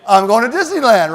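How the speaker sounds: loud, high yell of the slogan; yell into camera. Retold or imitated. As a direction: shout it straight down the lens. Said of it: "you're gonna yell into the camera".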